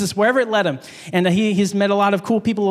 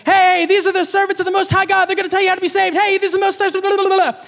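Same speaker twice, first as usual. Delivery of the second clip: mocking the slave girl; 9 semitones higher, faster than usual. Preacher mimics the slave girl pestering Paul, high and mocking. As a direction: mimic an annoying girl trailing them.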